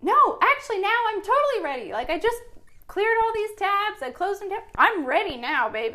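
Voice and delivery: loud, high, eager protest; eager wife. Acted. Wife to husband leaving for bed, eagerly protesting. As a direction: call it out high and loud, eager to stop him. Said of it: "And I was like".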